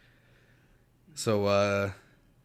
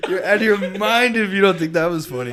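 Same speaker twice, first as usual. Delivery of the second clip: high, loud, helpless laughter through the words; cracking up; 12 semitones higher, 9 dB louder, slower than usual. Real, not acted. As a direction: gasp out praise while laughing hard. Cracking up at friend's joke.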